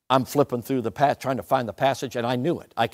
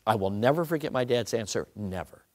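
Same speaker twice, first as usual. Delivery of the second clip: ordinary talk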